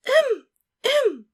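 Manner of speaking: very high, loud prissy ahem; fake cough. A narrator's reading. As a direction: interrupt with a pointed cough. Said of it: "Umbridge's fake cough".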